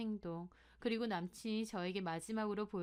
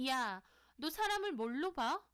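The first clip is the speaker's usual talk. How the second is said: higher, indignant challenge; offended anger; 5 semitones higher than usual. Acted. Sohee answers her friend's insulting offer, offended and angry. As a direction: challenge the insult with raised pitch.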